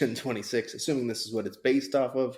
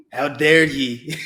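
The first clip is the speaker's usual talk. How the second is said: slow booming Scottish scare actor; Scottish accent; 5 semitones higher, 14 dB louder, much slower than usual. Pretend. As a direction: roar it slowly in a Scottish brogue. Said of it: "Can you say it like that Scare Actor did?".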